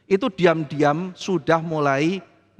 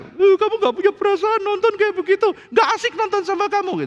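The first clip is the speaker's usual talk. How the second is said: much higher, put-on complaining voice; whiny wife; 3 dB louder than usual. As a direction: play the hurt wife, pitched way up. (istri merajuk dengan suara tinggi dibuat-buat)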